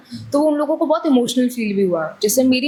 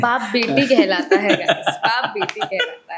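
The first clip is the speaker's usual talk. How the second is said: amused, laughing insistence; laughing correction; 2 semitones lower, slower than usual. Real, not acted. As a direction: talk through laughter. Laughing correction of teasing question.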